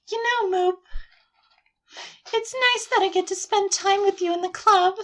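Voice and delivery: put-on high-pitched, affectionate game-girl voice; Sayori, sweet and friendly. Game girl glad of time with a club friend, high and sweet. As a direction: confide in a high girlish voice.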